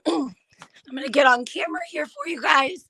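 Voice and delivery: usual speaking voice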